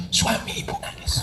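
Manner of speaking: hushed punchline whisper; quiet conspiratorial whisper. Acted. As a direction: drop to a whisper for the joke.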